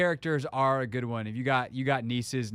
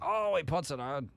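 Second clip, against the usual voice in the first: lightly voiced sportscaster call; a restrained play-by-play exclamation; Dave Johnson; 2 semitones higher than usual. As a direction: toss off a mild announcer impression. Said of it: "your Dave Johnson".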